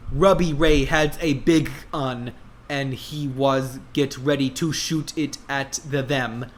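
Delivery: ordinary delivery, nothing marked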